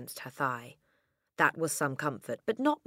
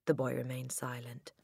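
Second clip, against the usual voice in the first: plain reading voice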